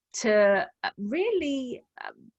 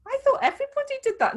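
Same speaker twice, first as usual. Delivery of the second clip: innocent surprise; much faster than usual. Retold or imitated. Retold naive thought, pitch jumps.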